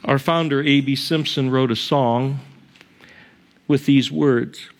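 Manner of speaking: ordinary talk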